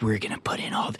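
sinister whispered mockery; mock villain whisper. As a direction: hiss it like a cartoon villain.

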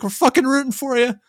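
squeaky high cartoon cheer; mock the fake support way up high; mock cheerful coworker. Mocking coworkers' thumbs-up cheer in a squeaky high voice. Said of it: "giving you a thumbs up like".

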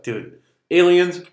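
high, loud, drawn-out incredulity; loud mock-incredulous questioning; retold disbelief. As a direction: recount it in exaggerated disbelief. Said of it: "I was like".

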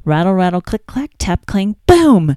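rising, bouncy read ending on a loud BOOM; storybook sound effects, playful; playful sound words. Shown as a demonstration. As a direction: build up to a big boom for a toddler. Reads book refrain with sounds.

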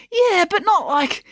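high, slow comeback cut short; exasperated retort. A narrator's reading. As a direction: argue back in a high voice. April argues back.

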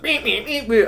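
high beeping "meep" noises, mocking a mate; imitated beeping noise. Telling the host how his mate beeped at the player, high mock beeps. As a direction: squeak out the meeps like a broken machine. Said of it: "he's like".

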